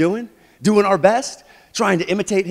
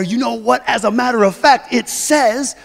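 high, loud know-it-all gotcha; triumphant gotcha; 6 semitones higher, 5 dB louder than usual. Acted. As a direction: stomp in and win the argument. Stomping in to win argument.

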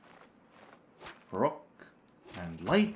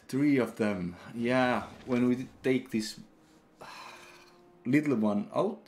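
ordinary talk, nothing marked

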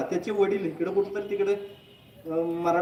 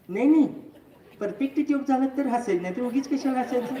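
mimicked stubborn patient, pitched up; grumpy refusal; 4 semitones higher than usual. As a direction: protest in a higher voice, refusing to be amused. Mimics unsmiling patient refusing to laugh.

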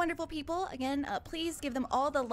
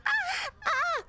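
two high, delighted shrieks; high-pitched overwhelmed "ah, ah"; excited squeals; 14 semitones higher, 3 dB louder than usual. Real, not acted. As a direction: squeal twice in happy disbelief. Reacting to the hype train.

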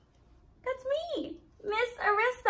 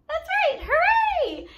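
much higher, louder laughing cheer; excited cheer; 12 semitones higher, 7 dB louder than usual. Real, not acted. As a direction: celebrate with a laugh. Cheering with children.

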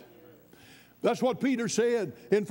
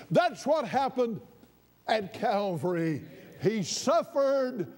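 higher, rising preacher's cry; full-force preaching; 4 semitones higher, slower than usual. Acted. Preacher to congregation, fervent high preaching. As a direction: drive the point home to the flock.

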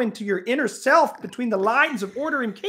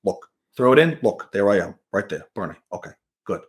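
Bernie impression; 12 semitones lower than usual. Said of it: "Bernie's like".

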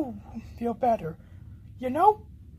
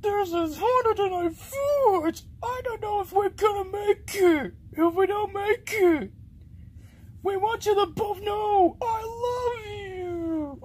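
high, wailing farewell; tearful panic; 6 semitones higher than usual. Acted. Telling friends of love before doom, panicked wail. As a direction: cry it out, drawing out the last words. Fears they will not survive.

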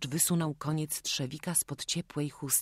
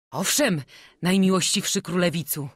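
eager craftsman Stanko; 4 semitones higher, 7 dB louder than usual. A narrator's reading. Stanko answers the prince eagerly.

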